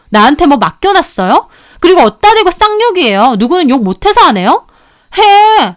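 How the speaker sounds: heated, indignant comeback; angry retort. Acted. Woman argues back at sister-in-law, angry retort. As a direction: argue right back, fed up. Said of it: "저도 완전 얼굴 빨개져서 같이 따졌습니다".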